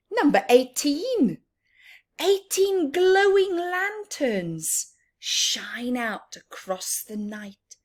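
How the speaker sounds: bright picture book reading; reading a rhyme aloud. Acted. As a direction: paint the night scene for the children. Reading rhyming verse to children.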